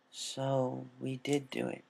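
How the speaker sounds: quiet, slow guilty murmur; sad realization. A narrator's reading. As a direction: let the guilt sink in. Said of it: "a look of sadness overcoming her".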